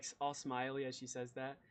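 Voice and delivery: ordinary talk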